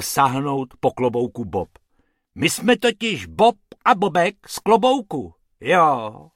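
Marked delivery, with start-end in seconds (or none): 2.3-6.3 louder threatening roar. Acted. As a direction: roar like a cutthroat. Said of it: "zařval pan Hrubec".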